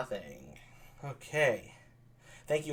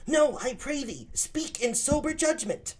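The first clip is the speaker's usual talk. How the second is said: put-on much higher, slow boyish voice; pleading young Claudio; 12 semitones higher, slower than usual. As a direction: beg him to speak seriously.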